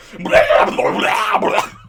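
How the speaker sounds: vintage cartoon voice. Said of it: "Here we've got the Tasmanian Devil".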